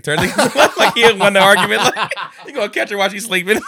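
high, loud, talking through big laughs; helpless laughter breaking into the words; laughing. Real, not acted. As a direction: crack up while telling the joke. Cracking up at own story.

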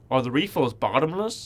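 put-on trying out an American accent. Rehearsing a question for the cafe lady, American accent. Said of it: "I can say that in my American accent".

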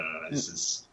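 ordinary talk

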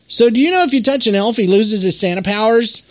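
put-on high, fast, loud kid voice; Jay's question. Son tells dad an elf fact, high, quick and eager. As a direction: rattle it off like an eager little boy. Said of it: "And Jay says".

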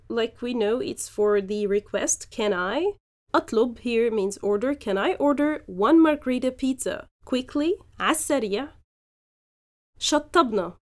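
ordinary talk

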